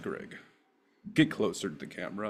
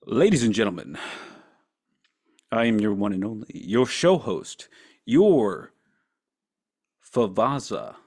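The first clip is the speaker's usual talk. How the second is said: loud, showy host intro; show host announcer; 7 dB louder than usual. Acted. Opening his stream to viewers, booming host announcer.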